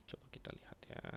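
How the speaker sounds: very soft, whispered aside to himself; self-talk murmur. Real, not acted. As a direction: murmur it under your breath while looking something up. Talking to himself at the whiteboard. (bergumam pelan sendiri)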